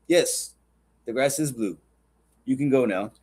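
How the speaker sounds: calm, measured lion, lightly voiced; lion's ruling. Lion dismissing the donkey, calm and final. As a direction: hand down the verdict evenly, with pauses. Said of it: "And the lion says".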